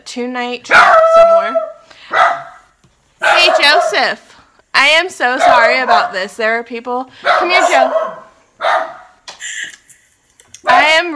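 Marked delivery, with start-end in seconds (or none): 3.2-4.4 loud slow call to the dog. Real, not acted. Vlogger calling her barking dog, loud and drawn out. 7.2-8.2 loud, higher call to the dog. Real, not acted. Vlogger calling her dog over, loud and higher.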